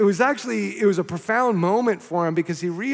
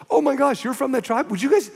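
higher, eager, breaking into a question; excited amazement; 6 semitones higher than usual. Retold or imitated. Preacher voicing missionary meeting the tribe's people, excited amazement. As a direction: act out delighted surprise in a raised pitch.

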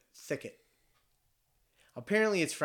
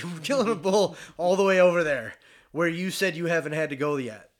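laughing brag; 4 semitones higher, 8 dB louder than usual. Retold or imitated. Bragging to the guide, laughing.

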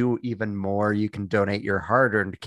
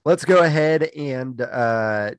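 ordinary talk

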